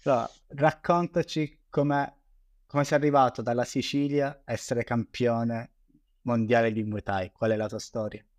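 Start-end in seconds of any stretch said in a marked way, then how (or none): none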